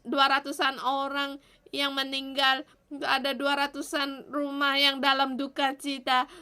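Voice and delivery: earnest pleading prayer to God; fervent prayer. Acted. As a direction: pray aloud for the city, pleading. Continues prayer, quieter. (doa yang sungguh-sungguh memohon)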